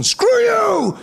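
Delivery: high shouted sign-off; hanging up on someone with a shout; mock phone slam yell. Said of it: "where you get to tell the person".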